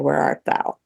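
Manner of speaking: usual speaking voice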